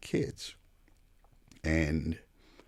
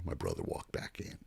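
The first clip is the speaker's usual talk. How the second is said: hushed; 11 semitones lower, 9 dB quieter than usual. Real, not acted. Tense story moment.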